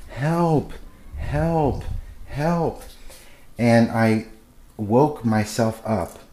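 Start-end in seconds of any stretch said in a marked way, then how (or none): 0.1-2.8 high retold cries of help, falling; imitating a panicked call for help; mock scream for help. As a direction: reenact the dream scream, pitched up. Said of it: "I was screaming out".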